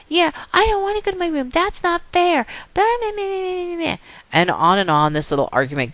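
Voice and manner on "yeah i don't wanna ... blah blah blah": very high bratty protest; acted squeaky tantrum voice; whining child. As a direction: whine back like a kid, dissolving into babble. Child argues back in story.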